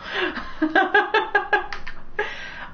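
helpless, voiceless cackling; long laugh. Real, not acted. Gamer laughing at own failure, long wheezing laugh. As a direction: keep laughing until out of air.

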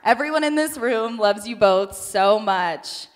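ordinary talk

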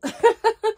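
high, loud little giggle; giggling laugh. Real, not acted. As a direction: laugh at your younger self. Laugh at young girl memory.

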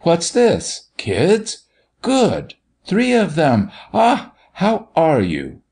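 high-pitched, blustering pretend indignation; mock indignant. A narrator's reading. Doctor making sport for the children, mock indignant. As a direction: make a show of threatening rage in fun. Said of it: "most indignant, not to say threatening".

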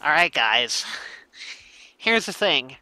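lightly voiced excited anime fan; a hint of a gushing streamer; Debush voice. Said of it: "that's my Debush impression".

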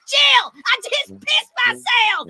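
high, slow shout through laughter; laughing hard. Acted. Roast guest crying out to his wife Jill, laughing shout. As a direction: call to your wife while cracking up.

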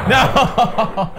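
high dismayed yelp; dismayed cry. Real, not acted. Player reacting to a quiz question, dismayed cry. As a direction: cry out as you lose. Wrong answer in quiz game.

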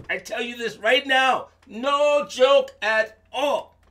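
high, loud mock fear; mock worried. Narrator fretting about the tiger, high and loud. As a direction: act scared of the tiger.

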